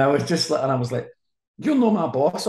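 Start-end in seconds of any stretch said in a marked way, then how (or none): 1.6-2.5 high, louder weak comeback; a feeble defiant reply; mock childish retort; pathetic retort. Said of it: "I said something pathetic".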